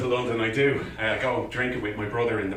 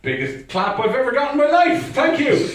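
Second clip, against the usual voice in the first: high-pitched, elated gratitude; excited thanks; 12 semitones higher, 5 dB louder than usual. Real, not acted. Comic thanking the clapping audience, excited. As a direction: call it out over the applause, thrilled.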